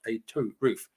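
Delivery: ordinary delivery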